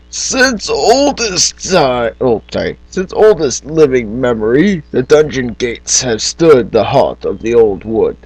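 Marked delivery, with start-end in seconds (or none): 0.1-2.1 slow, raised British narrator voice; grand storybook opening in a British accent. Acted. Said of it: "talking in a British accent". 2.9-8.2 put-on storybook narration in a British accent. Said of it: "talking in a British accent".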